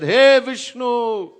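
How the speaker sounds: high, drawn-out appeal to Vishnu; dying demon's cry. Acted. Dying Rakshas calling on Vishnu, long high cry. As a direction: call out slowly to the god in protest. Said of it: "While dying, the Rakshas said".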